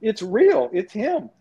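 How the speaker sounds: put-on high, amazed kid reaction; starstruck disbelief. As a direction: imitate awed kids seeing their idol. Starstruck kids see their idol.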